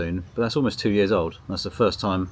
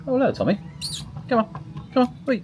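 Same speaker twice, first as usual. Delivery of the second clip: much higher, coaxing pet voice; talking to the cat. Real, not acted. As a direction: coax the cat in a high cooing voice. Greeting and coaxing his cat.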